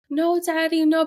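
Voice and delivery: high, loud imitated child; protesting little boy. Son to his crying daddy in what was a game, protesting little boy. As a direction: cry out like a kid whose game is spoiled.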